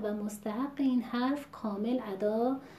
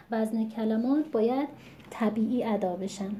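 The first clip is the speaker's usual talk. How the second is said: usual speaking voice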